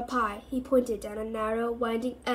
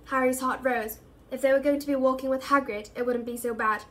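ordinary delivery

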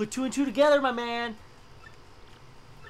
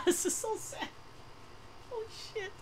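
high, hammed-up fake sobbing; mock crying; 5 dB quieter than usual. Streamer mock-sobs over starving family, high fake cry. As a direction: pretend to weep over the sad scene. Mock weeping at sad story.

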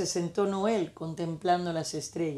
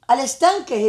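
loud, high call to the pond; barked call; 6 semitones higher, 8 dB louder than usual. A narrator's reading. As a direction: shout it like excited dogs. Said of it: "ellos ladraron".

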